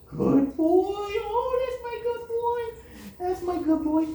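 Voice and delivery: very high, cooing praise for the puppy; gushing high puppy praise. Real, not acted. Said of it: "you don't need to do much baby talking".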